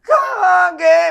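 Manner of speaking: high, loud, slow drawn-out call; wife's shrill call. Acted. Wife calls for her husband at dawn, loud and high. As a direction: call out across the house. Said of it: "ऐसे चिल्लाई".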